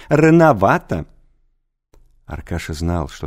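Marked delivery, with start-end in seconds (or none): 0.0-1.0 laughing. A narrator's reading. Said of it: "смеялся директор".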